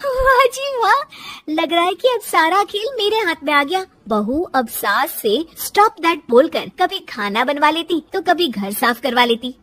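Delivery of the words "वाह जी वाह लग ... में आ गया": high, pleased exclamation; delighted, raised-pitch triumph; gleeful daughter-in-law. Acted. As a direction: crow over the discovery. Daughter-in-law gleeful at new power.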